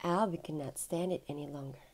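ordinary delivery, nothing marked

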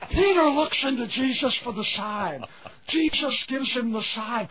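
normal talking voice

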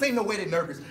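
ordinary talk

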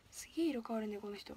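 hushed aside to viewers; whisper. Real, not acted. Streamer whispering to chat about a color-changing costume. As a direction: lean in and whisper it.

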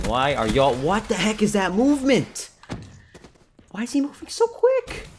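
loud, climbing into high disbelief; exasperated outburst. Real, not acted. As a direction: yell in real bafflement, pitch shooting up. Exasperated at enemy player's movement.

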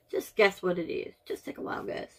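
teasing whisper to the camera; hushed playful challenge. Real, not acted. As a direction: lean in and whisper the dare. Teasing the viewers to guess.

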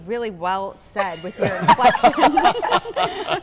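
laughing through the words. Real, not acted. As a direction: talk while laughing hard. Laughing while talking.

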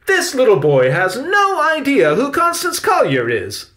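very high, loud outraged complaint; fired off. A narrator's reading. Victoria fires at Salvador about the boy, outraged. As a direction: fire the accusation at full outrage. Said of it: "Victoria fired at Salvador".

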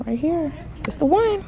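high, excited pick of the toy; thrilled to have found the one. Real, not acted. Mom to viewers, thrilled about the bike, excited. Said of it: "excited over chosen bike".

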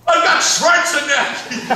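high, slow, loud mock exclamation. Director imitates actor in old-man makeup, loud excited impression. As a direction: stretch it into a hammed-up old-man yell.

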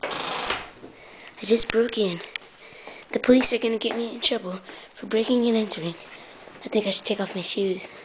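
low, soft, furtive murmur; low secretive narrating; sneaky hushed voice. Pretend. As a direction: keep it down like an intruder. Sneaking into Kevin's house.

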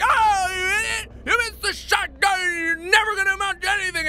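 hammed-up screaming put-down; mock scolding viewers. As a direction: berate like an outraged heckler. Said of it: "You guys are like".

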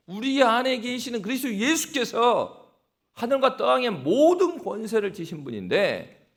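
usual speaking voice

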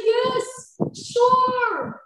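put-on high, loud little boy's reply; son's voice. Mother quoting her son's eager answer: high childlike voice. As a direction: pitch it up like her young son. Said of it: "He said".

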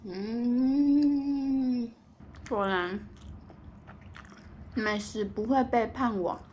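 words between chewing bites; talking while chewing. Real, not acted. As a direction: mouth full of chicken. Seen 3: Bites chicken at -7; cheeks bulge and jaw chews throughout speech.

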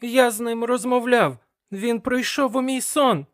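high-pitched, alarmed exclamation; agitated cry on waking; exclaiming. A narrator's reading. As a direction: blurt it out, pitch way up. Boy insists after nightmare.

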